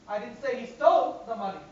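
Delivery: "stole" stressed. Shown as a demonstration. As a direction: hit "stole" in "I didn't say he stole the money". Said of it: "I'm going to stress on each word".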